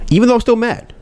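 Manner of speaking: high-pitched aside, dropping at the end; protesting aside. Acted. Inner voice admitting lingering anger after talking itself down, high protesting aside. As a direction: jump up high to slip in a confession. Aside undercutting his reasoned self-talk.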